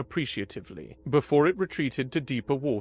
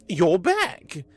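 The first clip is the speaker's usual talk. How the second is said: relieved; 5 semitones higher, 6 dB louder than usual. A narrator's reading. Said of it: "relief evident in his voice".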